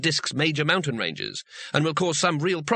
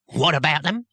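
short, louder snap; snapped; 4 dB louder than usual. A narrator's reading. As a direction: bite the question off. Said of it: "snapped Cohen".

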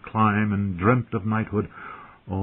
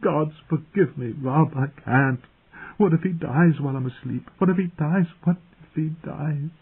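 high, tearful pleading; distraught, weepy and raised in pitch; weeping; 7 semitones higher than usual. A narrator's reading. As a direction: draw it out tearfully, rising into panic. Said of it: "she wept".

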